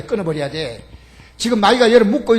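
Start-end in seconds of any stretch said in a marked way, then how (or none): 0.0-0.9 끊어버려야 돼 repeated lower and softer; soft echo. Acted. Preacher repeating the command to himself, quietly. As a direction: let 끊어버려야 drop to a quiet aside.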